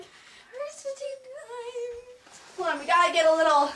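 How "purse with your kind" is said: slow, soft, sung mockery of her line; mocking singsong. Friend mocking the mean girl's line in singsong. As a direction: drag it out in a teasing singsong. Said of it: "You're like".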